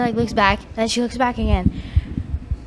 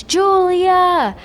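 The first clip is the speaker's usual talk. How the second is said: higher mock call across the beach; mom calling out; 7 semitones higher, much slower than usual. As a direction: call her name like a mom across the water. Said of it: "she screams".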